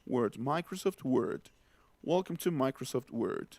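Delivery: Microsoft Word with an English werd; mock English announcement of Microsoft Word. Shown as a demonstration. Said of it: "Que se dice Word".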